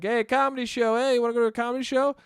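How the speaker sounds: lightly hammed-up sidewalk tout calling out; comedy club barker. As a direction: imitate a barker hawking a show to passersby. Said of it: "this guy who was barking".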